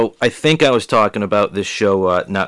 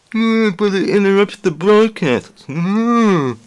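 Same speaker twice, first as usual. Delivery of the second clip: put-on voice; 10 semitones higher, slower than usual. Mock reply from listener.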